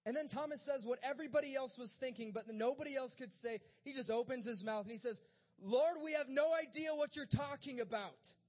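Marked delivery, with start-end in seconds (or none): 5.6-8.3 loud, high blurted confession; exasperated outburst. Acted. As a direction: blurt it out at full volume. Thomas blurts what nobody dared say.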